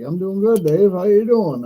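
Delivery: a hint of a friendly driller answering back; friend's voice. Friend voiced in retold story.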